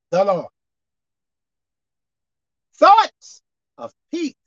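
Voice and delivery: very slow, with long pauses between phrases; slow reading. Shown as a demonstration. Preacher reading scripture so you guys get it, slowly. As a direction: read it a few words at a time, leaving big gaps. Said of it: "I'm gonna read it slow".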